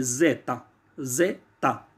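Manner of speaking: ज़ेता with a clear z, not जेड; Italian letter Z. Shown as a demonstration. Teacher to students: Z named ज़ेता, not zed. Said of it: "जेड नहीं बोलेंगे".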